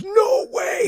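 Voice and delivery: high, loud cry of disbelief; the dad yelling no way at the gloves; sudden high shout; astonished shout. Retold or imitated. Dad protests boy signing gloves.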